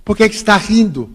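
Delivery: imitating an irritated stranger calling out; retold shout. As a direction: call out a challenge across a queue. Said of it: "alguém me olhou e gritou assim".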